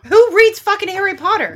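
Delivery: loud, high mock-outraged jab; playful shout. Real, not acted. Hitting back at a friend's Game of Thrones jab with a loud playful yell. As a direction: fire it back as a teasing yell. Playful comeback in book argument.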